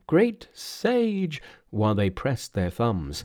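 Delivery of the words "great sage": high, drawn-out trial invocation; tentative murmured incantation. Acted. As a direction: intone it high, stretching the words.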